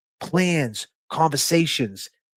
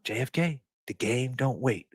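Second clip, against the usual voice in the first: quoting quietly; 8 dB quieter than usual. Retold or imitated. Retelling Mark Wahlberg's advice to JFK, quiet. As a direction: pass on the mentor's words quietly. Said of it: "That's what he told me".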